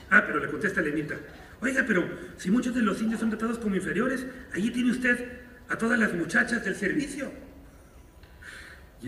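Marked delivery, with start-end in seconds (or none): 1.6-7.3 higher, quicker jab of a reporter's retort; a hint of a sly interviewer; pointed reporter retort. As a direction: lightly voice the cheeky young reporter catching him out.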